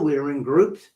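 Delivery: ordinary talk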